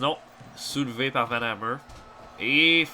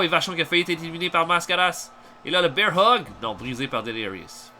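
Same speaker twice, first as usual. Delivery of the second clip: ordinary delivery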